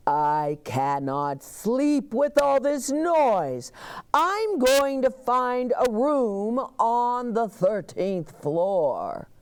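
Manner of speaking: slow, loud, grumpy guest; a grumpy guest's slow complaint; annoyed guest. Acted. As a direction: complain loudly and slowly to the front desk. Mister Snore complains.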